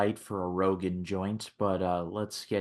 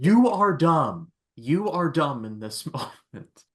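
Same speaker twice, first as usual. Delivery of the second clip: mock scolding; 6 semitones higher, 3 dB louder than usual. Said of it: "I was just sitting there like".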